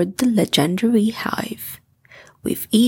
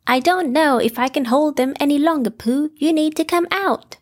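higher, fast, urgent Rabbit voice; straining to hold off the bees; 5 semitones higher, faster than usual. Acted. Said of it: "said Rabbit".

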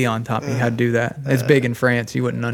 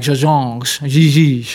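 put-on mushy French accent; slower than usual. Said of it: "mashed potatoes in your mouth".

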